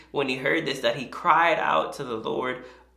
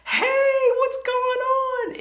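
much higher and louder, stretched greeting; overly cheerful hello; 5 dB louder, much slower than usual. Retold or imitated. Greeting someone who is angry, loud and chirpy. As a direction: sing out an oblivious happy hello. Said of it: "you're like".

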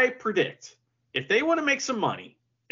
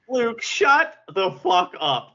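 mock scold; 8 semitones higher, 6 dB louder, slower than usual. Real, not acted. Friend mock-scolding co-host.

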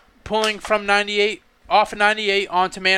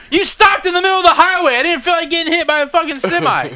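higher, insistent self-defence; retold indignant retort; 6 semitones higher than usual. Driver answers the old man who accused him, retold indignant retort. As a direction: re-enact justifying yourself, pitched up.

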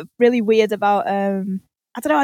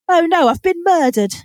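higher, deadpan mock dismay; mild mock surprise at being murdered; mock calm discovery; 7 semitones higher, 4 dB louder than usual. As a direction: play it like mildly inconvenienced news. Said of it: "they walk in and they go".

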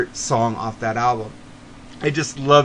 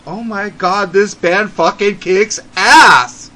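slow, much louder, higher outburst of amazement; excited discovery; 5 semitones higher, 6 dB louder, slower than usual. Retold or imitated. Host reliving hearing the CDs from his friend, loud amazed outburst. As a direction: belt it out like you just heard the best band ever. Retells first hearing the band.

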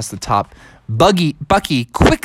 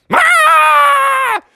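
high, loud monster scream; long high-pitched bellow; dragon screech; 5 dB louder than usual. Acted. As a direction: let out a piercing dragon shriek. Dragon cries out at thief.

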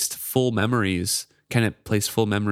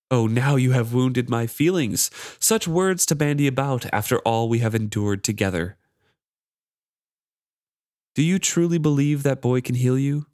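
a lightly voiced, higher, mocking magic charm; sly needling voice, slightly raised; taunting charm; 4 semitones higher than usual. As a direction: tease him from the shadows, higher. Reads the charm's taunting lines.

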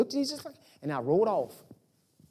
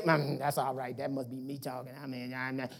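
dismissive mutter; 8 semitones lower, 10 dB quieter than usual. Retold or imitated. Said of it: "I could have said".

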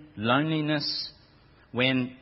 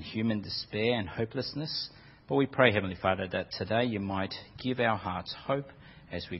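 prayer; 4 semitones lower than usual. Acted. Pastor leads congregation in prayer.

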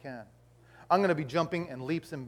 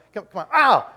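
loud, high burst ending in a yelp; mock pained yelp; 4 semitones higher, 10 dB louder than usual. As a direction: grumble into a sudden pained cry. Acts thorns poking him.

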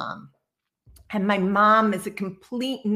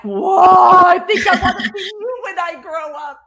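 much higher and louder, laughing through it; thrilled delight; 9 semitones higher, 11 dB louder than usual. Retold or imitated. Walking into the medium's purple room, excited laughing shout. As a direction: gush like a starstruck kid. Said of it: "I was like".

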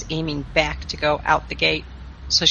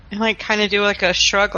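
ordinary delivery

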